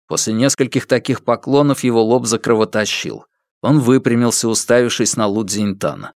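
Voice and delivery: usual speaking voice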